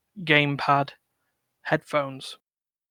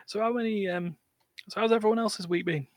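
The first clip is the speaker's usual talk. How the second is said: normal talking voice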